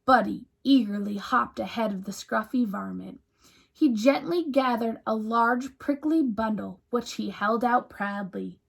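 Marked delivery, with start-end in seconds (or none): none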